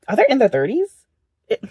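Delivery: ordinary talk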